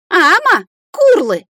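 high, loud creature cries; loud high dragon calls; scolding dragon exchange. A narrator's reading. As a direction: cry out in short, punchy bursts. Dragon mother spanking her child.

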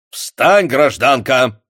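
loud, stern order; commanding tone. A narrator's reading. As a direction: order her up. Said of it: "приказным тоном проговорил Самойлов". (приказным тоном)

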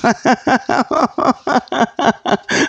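long chuckle; laugh. Real, not acted. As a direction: laugh at the cake joke. Laughs at cake joke.

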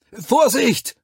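loud, very high cry; shouted warning. A narrator's reading. Soldier behind warning as the carrier slips, shouted. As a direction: yell a sudden alarm. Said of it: "schrie einer hinter mir".

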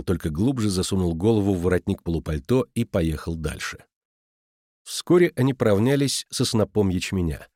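plain reading voice

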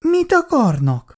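high, drawn-out frightened question. A narrator's reading. Girl startled by the threatening men, frightened. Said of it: "ijedt meg Icu".

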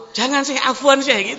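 emphatic refusal. Retold or imitated. You would refuse the sheikh loudly and insistently. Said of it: "Kalau antum".

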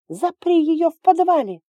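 high, loud yell. A narrator's reading. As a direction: scream it at full voice. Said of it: "орала Далия".

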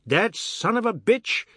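rising scream. Acted. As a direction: let the outrage climb. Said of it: "his voice was rising again".

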